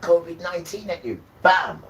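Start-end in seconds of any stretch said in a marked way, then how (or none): 1.4-1.8 loud, punchy Bam! like a blow landing; sudden loud bam. Acted. To the audience about covid being thrown at them, a loud punchy bam. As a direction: slam the word out like a hit.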